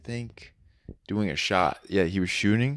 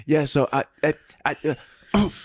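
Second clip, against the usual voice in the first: stammering joke teller; 6 semitones higher than usual. Retold or imitated. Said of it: "and then you're like".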